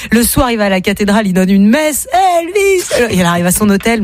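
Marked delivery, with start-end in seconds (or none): 2.4-3.1 high-pitched fan cry; mock crowd yell. Crowd drowning out the pope, high mock yell. Fans cry out during mass.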